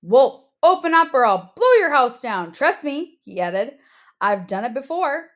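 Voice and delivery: loud bragging wolf threat; wolf's threat. Acted. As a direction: shout it like a cocky wolf. Wolf threatens second pig.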